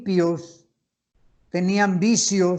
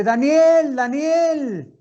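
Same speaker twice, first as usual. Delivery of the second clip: high, loud calling out across the pit; king shouting a name; 7 semitones higher, 4 dB louder, much slower than usual. Retold or imitated. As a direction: call out anxiously to someone unseen below. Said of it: "Imagínense al rey Darío gritándole".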